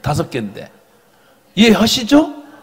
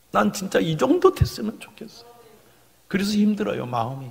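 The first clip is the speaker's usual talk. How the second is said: normal talking voice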